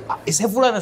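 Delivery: high mock child's reaction; imitated alarmed child. One little boy to another about the deaconess, mock alarm. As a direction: pitch it up like a little kid realising. Child recognising the feared woman.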